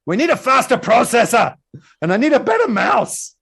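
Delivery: put-on high, loud kid demanding upgrades; hammed-up angry little boy. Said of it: "he's angry".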